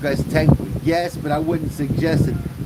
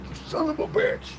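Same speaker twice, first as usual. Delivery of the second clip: high, breathy strained cry while beating him; retelling a fight, a mock strained curse; strained mock yell; 14 semitones higher, 4 dB quieter than usual. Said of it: "It's like".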